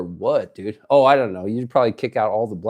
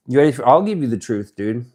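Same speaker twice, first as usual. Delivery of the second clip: normal talking voice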